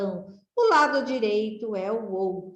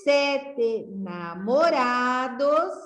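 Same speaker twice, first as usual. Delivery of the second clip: slow reading aloud; 3 semitones higher, much slower than usual. Shown as a demonstration. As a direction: read the rhyme out slowly, syllable by syllable. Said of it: "Vamos ler?".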